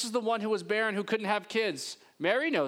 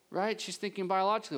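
ordinary talk, nothing marked

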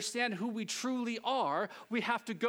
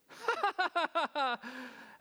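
long, high put-on laugh; fake laughter; 6 semitones higher than usual. Newcomer trying to impress the group, fake laughter. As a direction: laugh along with no idea of the joke. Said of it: "and you start laughing".

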